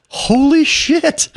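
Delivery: high, slow stunned disbelief. Retold or imitated. Father hearing his son's dead-on impression, stunned. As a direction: let it drop out in amazement.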